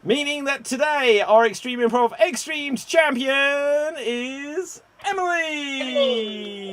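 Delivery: high, loud game-show announcer; big triumphant champion reveal; winner announcement. Real, not acted. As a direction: build up grandly and stretch out the winner's name.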